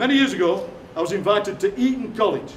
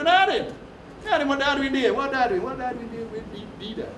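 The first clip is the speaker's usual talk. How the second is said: higher-pitched Sheffield voice, th turned to a hard d; Sheffield deedar accent; 4 semitones higher than usual. Shown as a demonstration. Comic mimics his Sheffield neighbours, deedar accent. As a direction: exaggerate the Sheffield d, "nah den". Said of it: "because they put a hard dirt on the "the".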